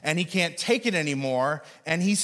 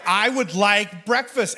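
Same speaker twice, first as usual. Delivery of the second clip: slow, louder, firm demand for breakfast; menacing demand; 3 semitones higher, 4 dB louder than usual. Retold or imitated. Gunman at the burger counter insisting, slow and louder. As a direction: lean on every word like a threat. Pulls out a Tech nine.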